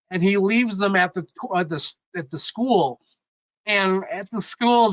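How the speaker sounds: high, choked-up storytelling; getting emotional. Real, not acted. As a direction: hold back tears while telling the story. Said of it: "I'm gonna get emotional".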